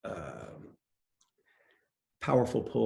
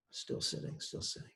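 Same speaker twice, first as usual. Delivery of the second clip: whisper; 14 dB quieter than usual. Retold or imitated. Stuck watching a late movie.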